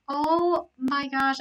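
ordinary talk

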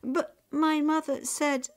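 put-on pleading high girl's voice; protesting girl. A narrator's reading. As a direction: try to explain before being cut off. Girl protesting, cut off by father.

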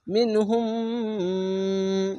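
slow "মিন হুম" with a sustained nasal m; nasal ghunna. Shown as a demonstration. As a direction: hum the final m of "হুম" very long. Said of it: "ঈদগামে মিম সাকিন গুন্না".

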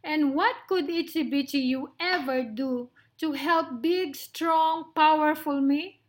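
loud, boastful lion; mock the tiny mouse with lofty pride; amused scoff. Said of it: "laughed Lionel".

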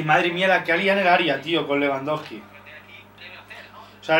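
ordinary delivery

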